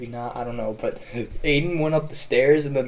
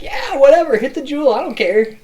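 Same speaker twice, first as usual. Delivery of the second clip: put-on very high, loud, careless drunk voice; carefree drunk; 12 semitones higher, 11 dB louder than usual. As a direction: toss it off like nothing matters. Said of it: "he was so drunk, he was just like".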